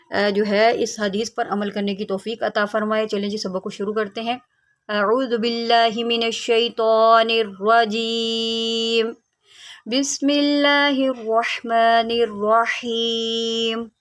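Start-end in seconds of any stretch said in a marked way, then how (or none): 4.9-13.9 slow measured tajweed chant; reciting before the lesson; slow Quran recitation. Shown as a demonstration. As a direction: recite it slowly and melodically. Recites ta'awwuz and bismillah.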